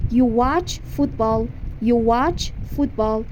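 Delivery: slow teacher demo of the sentence; slow model sentence. Shown as a demonstration. Teacher modelling English for Persian students, slow. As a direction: say it slowly for repetition.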